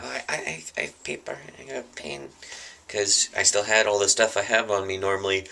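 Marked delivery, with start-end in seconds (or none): 0.0-2.3 much softer, breathy offer of paper and pen; timid half-whisper. Acted. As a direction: whisper it shyly, afraid to be noticed. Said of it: "I'm like".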